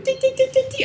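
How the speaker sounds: high quick tee tee tee; piping sound effect; squeaky tiptoe noise. Acted. Giant sneaking up, high squeaky tee sounds.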